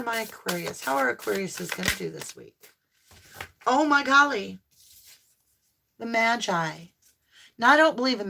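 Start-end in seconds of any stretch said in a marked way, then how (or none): 3.7-4.6 surprised delight. Real, not acted. Card reveal delights reader.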